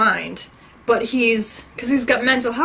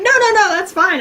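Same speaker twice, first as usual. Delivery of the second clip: higher and louder, eager no-no-no; excited insistence; 10 semitones higher, 7 dB louder than usual. Retold or imitated. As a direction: brush off his apology, thrilled. Eager reply to gift offer.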